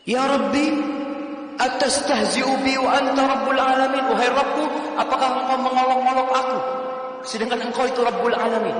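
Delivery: high, loud, disbelieving cry to the Lord; astonished plea. Acted. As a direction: plead as if you can't believe your luck. The man's astonished plea in heaven.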